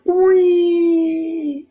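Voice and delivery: high, slow drawn-out boing; cartoon boing. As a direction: stretch out a silly cartoon boing. Imitating deflating monster sound.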